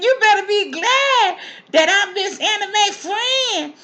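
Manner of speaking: high, slow, loud telling-off; loud scolding. Pretend. Window lady scolds John Henry loudly and slowly. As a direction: wag a finger with your voice.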